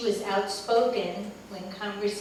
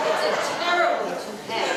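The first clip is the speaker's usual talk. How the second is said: louder, laugh in the voice; laughing aside; 3 semitones higher, 7 dB louder than usual. Real, not acted. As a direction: say it still laughing.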